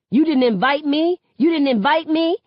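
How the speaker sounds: high loud sneering mimicry of her; mocking imitation. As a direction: parrot her words back to mock her. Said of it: "he mocks".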